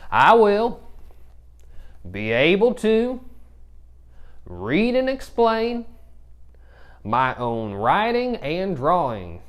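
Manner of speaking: high, loud hammed-up cowboy. Shown as a demonstration. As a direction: yeehaw it like a cowboy. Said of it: "we're gonna say it with a cowboy voice".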